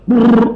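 loud high brrr of a shiver; loud shudder. Acted. Man shuddering at the outfit, loud high brrr.

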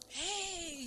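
high-pitched, soft storybook holler; mock shout. A narrator's reading. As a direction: call out high without much volume. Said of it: "schreeuwde het schaap Veronica".

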